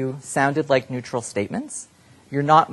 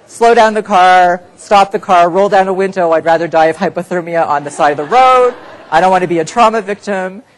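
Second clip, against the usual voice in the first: direct order; 7 semitones higher, 12 dB louder than usual. Shown as a demonstration. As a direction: order the driver to stop, no hedging. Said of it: "tell me what you would say".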